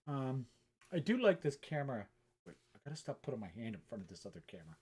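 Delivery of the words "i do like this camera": usual speaking voice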